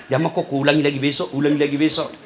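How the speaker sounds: low, soft coaxing devil voice; sly whispering tempter; tempting devil. Acted. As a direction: lure him like a devil, softly and low. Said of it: "goda ki setan".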